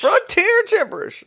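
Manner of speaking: very high cartoon frontier squawk; comic high-pitched yelp; mock frontier voice. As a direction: echo it in a squeaky Western-cook voice. Echoes it in cartoon cook voice.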